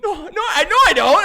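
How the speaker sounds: high, loud, laughing protest; protesting wife. Retold or imitated. Wife denying the kid's accusation, loud laughing protest. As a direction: imitate a flustered mom insisting through laughter. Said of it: "And Becca's like".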